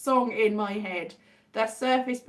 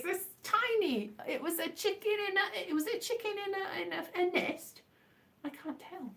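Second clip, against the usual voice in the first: higher and softer, squinting at something tiny; quiet peering; 7 semitones higher, 7 dB quieter than usual. Real, not acted. Reading a tiny chat emoji, quiet peering. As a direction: murmur while squinting at a small picture.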